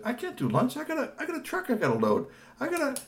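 high flustered refusal; high Tony voice. Acted. Tony refusing lunch with Kashi, flustered. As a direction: protest he's busy.

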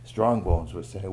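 ordinary delivery

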